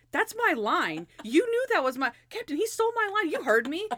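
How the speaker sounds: high, hurried mock protest; acted childish outrage; whiny indignation. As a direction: tattle to the boss, pitch climbing. Randy accuses sergeant of stealing line.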